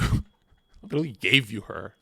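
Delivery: ordinary delivery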